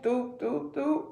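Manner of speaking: ordinary delivery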